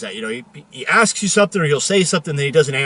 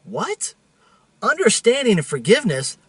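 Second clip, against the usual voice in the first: higher, puzzled double take on Understanding and forgiveness; incredulous; 7 semitones higher than usual. Retold or imitated. Driver echoing God's answer, mock incredulous. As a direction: repeat it back in disbelief. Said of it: "And I'm like".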